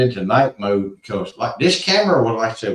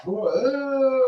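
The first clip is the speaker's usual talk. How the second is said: long, high whooping howl; imitated squatch call. Storyteller imitates the squatch heard by the fire, high whoop. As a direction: hoot like a distant squatch, drawn out and high. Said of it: "you could hear a squatch".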